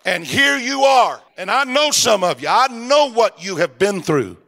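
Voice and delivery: high, loud preacher's climb; rising sermon cry; preaching at full force. Acted. Preaching climax, raised pitch.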